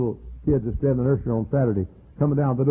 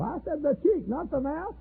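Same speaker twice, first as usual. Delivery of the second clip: mock scolding; 6 dB quieter than usual.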